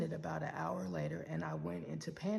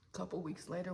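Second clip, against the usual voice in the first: ordinary talk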